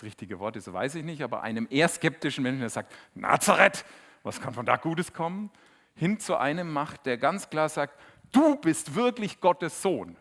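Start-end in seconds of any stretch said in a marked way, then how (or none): 3.1-5.5 high, loud scoffing Nazareth with a mocking rise; scornful doubt. Nathanael zweifelt erneut, nacherzählt. 8.3-10.2 slow, loud and higher, every word weighed; awed exclamation. Retold or imitated. Der Skeptiker bekennt Jesus.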